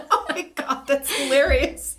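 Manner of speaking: high, laughing disbelief. Real, not acted. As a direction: laugh through the words. Laughing at the story.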